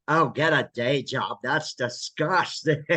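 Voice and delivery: camp game-show quip, slowed; Paul Lynde. Retold or imitated. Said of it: "he tends to do Paul Lynde like".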